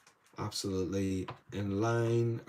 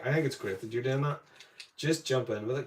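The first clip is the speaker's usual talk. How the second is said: normal talking voice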